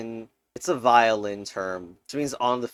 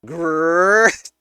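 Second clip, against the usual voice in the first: a short growl of annoyance at the clue; frustrated growl; 4 semitones higher, 8 dB louder than usual. Real, not acted. Growling at a crossword clue that won't fit, frustrated growl. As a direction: growl through your teeth, stuck.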